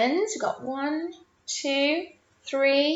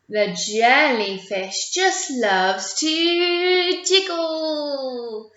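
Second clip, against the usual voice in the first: put-on reading rhyme to children; 4 semitones higher, 3 dB louder, slower than usual. A narrator's reading.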